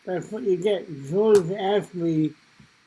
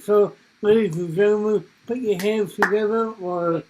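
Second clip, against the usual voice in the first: louder, bigger stage-host intro; showman announcer; 3 semitones higher, 5 dB louder than usual. Acted. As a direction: hype up the crowd like an emcee. Host introducing act to audience.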